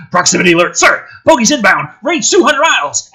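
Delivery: loud, high urgent shout down a phone; yelling report. Acted. Soldier yelling the alert to his commander. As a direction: bark the alert like a panicked soldier. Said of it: "clutches a phone receiver and yells".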